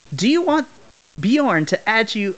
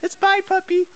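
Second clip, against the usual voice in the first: high sing-song bye to the puppy; playful farewell; 12 semitones higher than usual. Acted. Interviewer says goodbye to the puppy, high sing-song.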